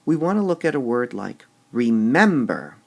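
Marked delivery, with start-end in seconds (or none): 1.7-2.8 remember with a loud, raised MEM; stress on middle syllable. Shown as a demonstration. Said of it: "Would we say the word".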